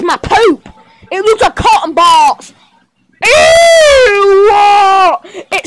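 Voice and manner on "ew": loud high Ew!; a child's mock scream of disgust; disgusted shriek. Poop shown off to toys.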